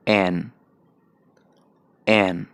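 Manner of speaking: American English an; American accent. Shown as a demonstration. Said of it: "American में".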